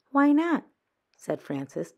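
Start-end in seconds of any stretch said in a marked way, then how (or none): 0.1-0.6 higher, louder eager ask; eager question. A narrator's reading. Frances presses Thelma to sell, eager. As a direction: jump at the chance.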